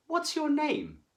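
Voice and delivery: high teacher's question to a new pupil; teacher asking. Acted. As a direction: ask it as a bright teacher would. Teacher asks the monster.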